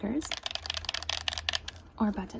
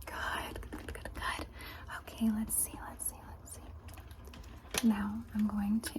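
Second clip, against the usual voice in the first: soft, softer whispered praise; ASMR whisper; 12 dB quieter, slower than usual. Acted. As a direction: whisper praise close and gentle.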